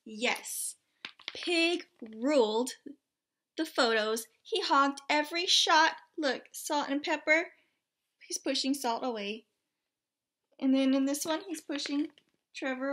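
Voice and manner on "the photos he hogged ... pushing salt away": ordinary delivery, nothing marked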